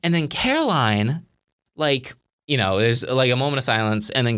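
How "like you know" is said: usual speaking voice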